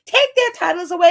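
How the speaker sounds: high shouted mockery of the bullies, sped up on the repeat; mocking bullies. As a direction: yell it like the jeering bullies, then rattle it off. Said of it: "nonsense from those horrible bullies".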